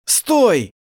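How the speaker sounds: sudden loud stop; sharp shout. Acted. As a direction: shout it to halt him. Grabbing someone to stop.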